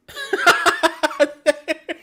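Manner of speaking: high helpless burst of laughter; cracking up at the defending; laughing. Real, not acted. West Ham concede, streamer cracks up.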